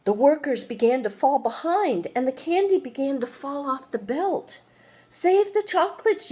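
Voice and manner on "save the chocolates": high, loud panicked shout; yelling. Acted. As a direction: cry out to save them. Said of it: "yelled the man".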